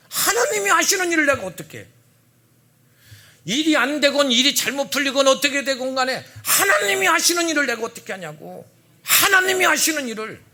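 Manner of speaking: high, loud preaching cry; laughing, soaring pulpit voice; fervent climax. Real, not acted. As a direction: proclaim it with full force. Repeated climactic sermon line.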